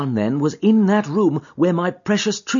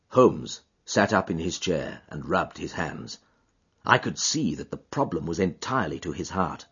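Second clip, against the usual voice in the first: much lower, measured storyteller voice; deep narrator voice; 11 semitones lower, 7 dB quieter than usual. A narrator's reading. Second narrator voice for the frame.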